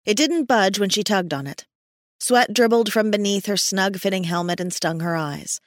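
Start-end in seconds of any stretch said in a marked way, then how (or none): none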